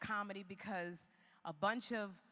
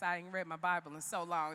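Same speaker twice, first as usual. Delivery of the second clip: ordinary talk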